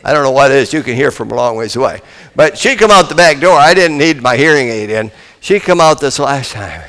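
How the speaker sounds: usual speaking voice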